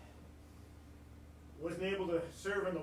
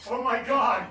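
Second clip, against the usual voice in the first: loud, high cry of readiness; hammed-up emergency holler; urgent shout; 5 semitones higher, 8 dB louder, slower than usual. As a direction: burst in to the rescue. Answering a call for help.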